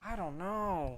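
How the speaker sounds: puzzled singsong at normal volume; stumped singsong. Real, not acted. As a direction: lilt up and back down, stumped. Stumped at guessing the accent.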